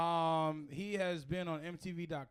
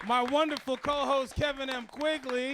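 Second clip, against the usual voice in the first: higher, loud announcer call; emcee introduction; 7 semitones higher, 8 dB louder than usual. Real, not acted. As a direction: present your co-host to a cheering crowd. Host announces co-host to crowd.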